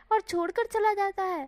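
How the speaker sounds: loud, high indignant complaint. Acted. Wing scolding Wang for leaving, loud and indignant. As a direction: throw the grievance at him. Wing's grievance.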